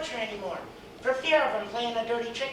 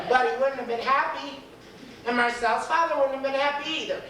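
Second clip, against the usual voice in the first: plain reading voice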